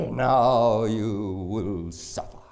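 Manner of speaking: slow, menacing threat then loud order; mock villain threat. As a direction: play the sadistic dentist.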